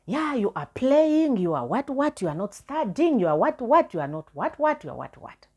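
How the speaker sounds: mock the mother telling her off; scolding mother. Mother scolding her daughter over failed results, shrill high nagging. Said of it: "my mother on me".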